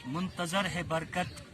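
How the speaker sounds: measured mushaira verse recital; poetry recitation. Acted. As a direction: recite the couplet with phrase pauses.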